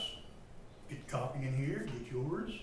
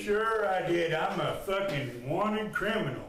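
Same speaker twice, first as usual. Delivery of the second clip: angry raised voice; 3 semitones higher than usual. Real, not acted. Detainee ranting back at officers over charges, angry raised voice. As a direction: really rail at them, voice raised. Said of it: "you lower your tone".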